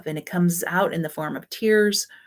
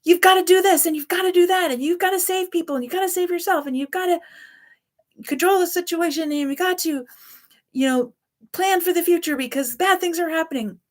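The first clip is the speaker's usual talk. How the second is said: very high, loud emergency alarm; panicked trauma voice; 12 semitones higher, 4 dB louder, faster than usual. Acted. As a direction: sound the alarm like a frightened child. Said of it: "They think it's their higher power saying".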